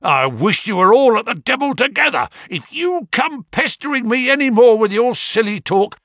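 loud, high, furious market trader; a shopkeeper roaring at a pest; angry shout. A narrator's reading. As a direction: bellow him off your stall. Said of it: "he shouted".

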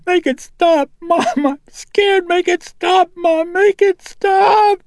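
put-on very high, loud child's voice breaking into crying, last words drawn out slowly; frightened girl begging her mother to stop the shaking bed; panicked sobbing plea. A narrator's reading. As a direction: beg in rising terror. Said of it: "she cried".

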